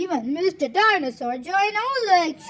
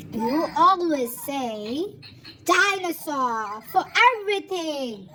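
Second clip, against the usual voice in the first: plain reading voice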